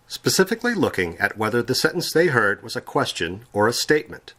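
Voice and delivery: normal talking voice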